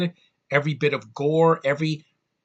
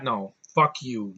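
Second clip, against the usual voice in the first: ordinary talk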